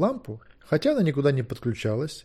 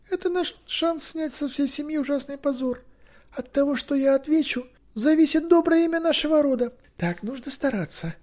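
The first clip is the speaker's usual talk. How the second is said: put-on very high girlish voice; high-pitched girl thinking aloud; anxious eager girl. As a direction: talk yourself through it, high and earnest. Girl reads letter, anxious.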